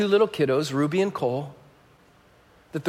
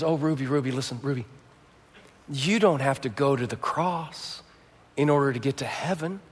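gentle reassurance; 6 semitones lower, 7 dB quieter than usual. Retold or imitated. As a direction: soothe a frightened daughter. Mother comforting scared child.

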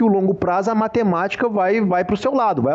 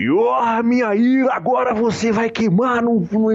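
higher mock voice of divine wrath; mock-thundering threat from on high; wrathful God; 5 semitones higher than usual. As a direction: play an angry God, hammed-up. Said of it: "que senão Deus vai".

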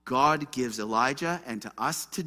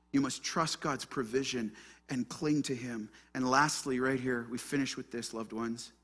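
ordinary talk, nothing marked

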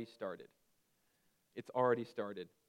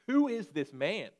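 slow, loud and higher, asking with awe; a preacher's ringing challenge to the room; booming question; 6 semitones higher, 6 dB louder, slower than usual. Acted. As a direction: lean in and boom the question out slowly. Preacher poses the big question.

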